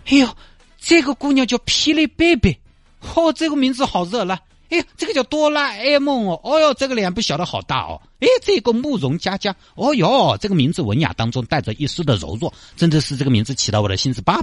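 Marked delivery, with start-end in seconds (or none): 0.1-10.4 giddy, high-pitched browsing; excited high voice. Acted. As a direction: squeal over each name. Guo excitedly browsing profiles.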